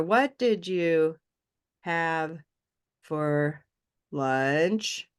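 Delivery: slow, separate 'What did you / have / for / lunch'; British-style intonation, broken up. Shown as a demonstration. Teacher breaking down British intonation, slow separated words. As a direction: step down in pieces, long pauses before 'have', 'for', 'lunch'. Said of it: "it'd be very similar".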